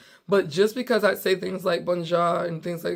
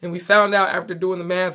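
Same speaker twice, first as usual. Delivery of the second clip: normal talking voice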